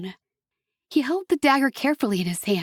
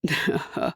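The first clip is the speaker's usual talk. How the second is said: low, soft, rumbling devil's chuckle; a dark chuckle deep in the chest; a low, rumbling laugh; 6 semitones lower, 4 dB quieter than usual. A narrator's reading. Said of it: "a low, rumbling sound".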